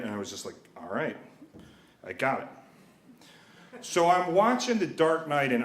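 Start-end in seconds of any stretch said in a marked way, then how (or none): 0.8-2.5 subdued acceptance. Retold or imitated. Kid meekly accepts dad's lesson.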